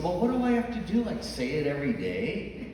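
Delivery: high, fast, flustered protest; a husband caught off guard. Retold or imitated. Husband protests to his wife, high and rushed. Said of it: "And he said".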